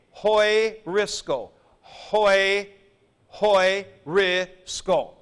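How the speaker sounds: hoi-risco, slow, hoi set apart, s and k joined; careful pronunciation. Shown as a demonstration. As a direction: stretch out hoi-risco, keeping sk together. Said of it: "pronounced hoi-risco with S K together".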